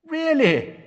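high, eager question; excited. Acted. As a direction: burst with curiosity. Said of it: "voice excitedly".

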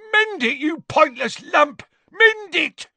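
high, loud screamed order; angry scream. A narrator's reading. Gaunt to daughter over broken pot, screaming. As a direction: scream it at a useless underling. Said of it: "Gaunt screamed".

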